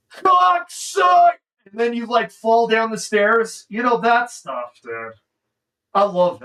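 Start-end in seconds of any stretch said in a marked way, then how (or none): 0.1-1.4 mock pained yell. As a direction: yelp it like you just hit your head on a lamp. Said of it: "hit your head on a lamp".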